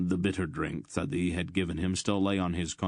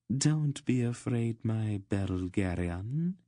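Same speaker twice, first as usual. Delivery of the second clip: slow, seductive queen's purr; higher, silky reassurance; 4 semitones higher, slower than usual. Acted. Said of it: "she purred at him".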